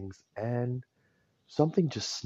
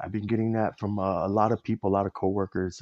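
ordinary delivery

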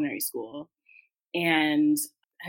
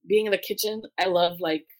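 ordinary talk

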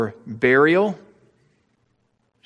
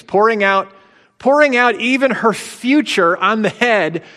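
louder, higher preaching build; rising fervour; 6 semitones higher, 4 dB louder than usual. Real, not acted. Preacher to congregation, swelling fervent build. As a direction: pour it out with mounting passion.

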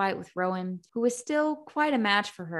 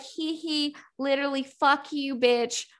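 mock sweet then angry; 5 semitones higher, 6 dB louder than usual. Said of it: "I was like".

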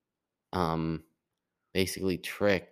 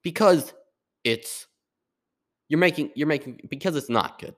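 pitched-up exasperated comedian take; imitating Jerry Seinfeld; 9 semitones higher, 5 dB louder than usual. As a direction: go high and incredulous, like explaining the obvious. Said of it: "and Jerry's like".

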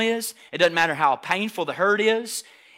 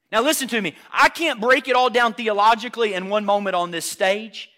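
ordinary talk

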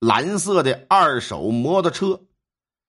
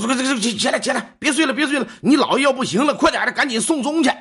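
higher, hurried urging; urgent rousing; 8 semitones higher, faster than usual. Retold or imitated. Father waking his children at night, urgent and high. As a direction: rouse sleepers in a rush, pitched high.

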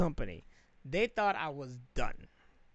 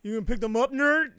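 a hint of a taunting bully, higher and louder on nerd; mock bully; 5 semitones higher, 6 dB louder than usual. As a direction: sneer it at the supervisor like a jock. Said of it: "That's what you should have said".

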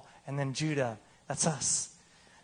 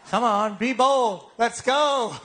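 loud, higher, slow rallying call; urging call; 5 semitones higher, 6 dB louder, slower than usual. Real, not acted. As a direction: rouse the crowd to be bold. Preacher urging congregation to repent.

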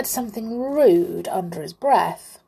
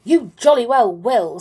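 loud, raised, angry retort; heated comeback in a quarrel; 4 semitones higher, 8 dB louder than usual. A narrator's reading. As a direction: insist angrily, hitting each word. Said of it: "said the clown angrily".

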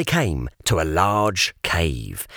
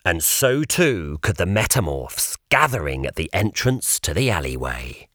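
ordinary delivery, nothing marked

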